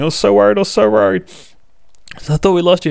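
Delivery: high, frantic parent's cry of relief; frantic relieved cry. Acted. Parents running out to Lucy, frantic high relieved cry. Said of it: "The parents run out screaming".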